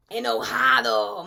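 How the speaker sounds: a hammed-up angry bark; playful mock-angry Enojado. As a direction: say Enojado like a cross kid. Says angry word angrily.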